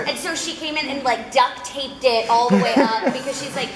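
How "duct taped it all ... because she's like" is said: usual speaking voice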